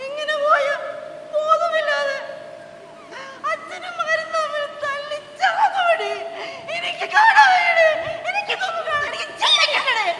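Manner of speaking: high, loud, breaking into sobs; crying loudly, sobbing through the words; sobbing wail. Acted. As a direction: wail it out in despair.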